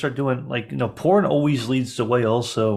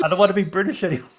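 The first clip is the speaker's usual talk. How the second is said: fast, high mock whine; hammed-up complaint, pitched up; distraught actor; 4 semitones higher, much faster than usual. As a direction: rush out a childish protest, half laughing.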